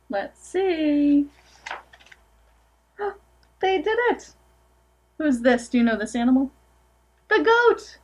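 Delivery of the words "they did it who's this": plain reading voice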